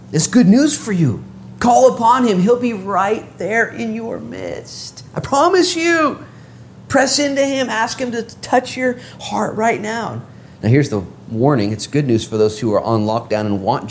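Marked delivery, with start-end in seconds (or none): 0.1-10.2 high, drawn-out pleading proclamation; impassioned preaching. Real, not acted. Preacher urges listeners to press in, impassioned. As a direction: exhort them earnestly, pitched high and stretched.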